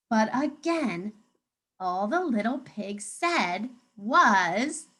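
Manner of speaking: ordinary talk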